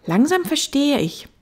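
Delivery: little prince. Acted. Little prince starting to understand the fox, high child voice.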